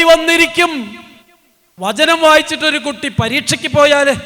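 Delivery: loud, high, fervent preaching; preaching at full force. Acted. Preacher rousing the congregation about his daughter, loud fervent preaching. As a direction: preach it out to the hall with fire.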